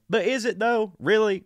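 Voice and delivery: high, incredulous "is it though"; skeptical mock question. Mocking doubt about microwave.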